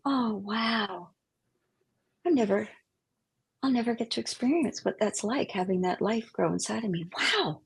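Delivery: soft, wistful musing to oneself; quietly marvel, as if alone; softly acted private awe; hushed wonder. Alone at mirror, wistful wonder retold.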